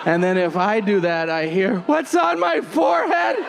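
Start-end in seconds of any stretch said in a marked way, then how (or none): 1.8-3.4 high-pitched mock alarm; alarmed woman. Woman under him asking in alarm, high mock voice. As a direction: squeal the question in a put-on high voice.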